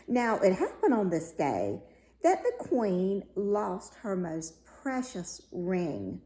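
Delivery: plain reading voice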